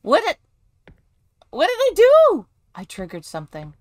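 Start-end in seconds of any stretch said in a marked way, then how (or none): 0.0-2.5 high, loud squeal; panicked question. Real, not acted. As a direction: shriek the question in alarm. Triggered something unexpectedly.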